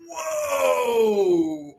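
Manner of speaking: long, high drawn-out reaction; hammed-up taken-aback cry; retold shock. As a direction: stretch it out in mock astonishment. Recoils at friend's insult, retold.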